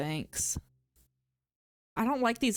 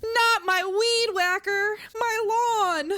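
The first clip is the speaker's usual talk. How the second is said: high, loud, drawn-out protest; mock horrified wail; 11 semitones higher, 6 dB louder, much slower than usual. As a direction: cry out in hammed-up despair at losing it. Acted horror at losing weed whacker.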